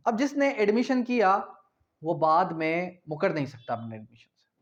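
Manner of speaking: ordinary talk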